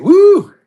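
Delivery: high, loud cheer; excited whoop. Real, not acted. Host celebrates a fun round, high loud whoop. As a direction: let out a big woo of delight.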